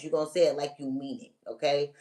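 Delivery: ordinary talk, nothing marked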